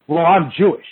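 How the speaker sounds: ordinary talk, nothing marked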